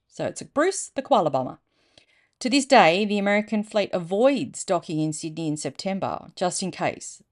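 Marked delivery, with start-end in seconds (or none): none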